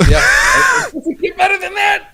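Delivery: shouting through laughter; laughing shout. Real, not acted. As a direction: yell it while cracking up.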